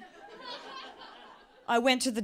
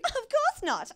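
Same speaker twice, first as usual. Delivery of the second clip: much higher, squeaky guilty denial; 4 dB louder, slower than usual. Retold or imitated. Voicing couple's denial.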